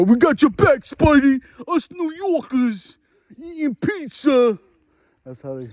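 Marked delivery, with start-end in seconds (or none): 0.0-4.6 cheering New York fans. Retold or imitated. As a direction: play a goofy fan yelling support for Spidey. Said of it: "one of them back row".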